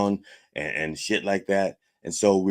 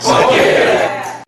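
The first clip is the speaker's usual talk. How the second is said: loud high whooping shout; shouted fuck yeah; 13 semitones higher, 10 dB louder than usual. Acted. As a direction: yell fuck yeah at the top of your lungs. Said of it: "If I ask for a".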